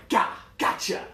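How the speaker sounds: loud, high burst of triumph; mock-triumphant outburst; triumphant gotcha. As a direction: pounce on it, punching each word. Voicing his triumphant comeback.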